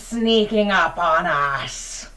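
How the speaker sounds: slow, loud and drawn out; looming challenge. Acted. Game master's creature challenging the hidden party, loud and slow. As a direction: call out the intruders menacingly. GM voices an unseen threat.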